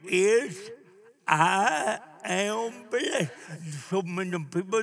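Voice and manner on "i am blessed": half-speed, each word stretched out; slow declaration. Shown as a demonstration. Preacher declares his story to congregation, slow proclamation. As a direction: proclaim it, drawing out every word.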